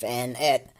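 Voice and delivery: ordinary talk, nothing marked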